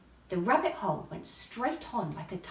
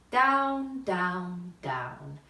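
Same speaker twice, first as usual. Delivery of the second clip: each down lower than the last; falling down; 3 semitones lower, 3 dB louder, much slower than usual. A narrator's reading. Narrator describing Alice's fall, each down lower.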